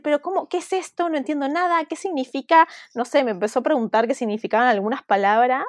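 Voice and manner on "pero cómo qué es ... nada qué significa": higher, puzzled mock boss voice. Client baffled by the slides, mock puzzled voice. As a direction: imitate a confused older man, pitched up. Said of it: "me empezó a decir".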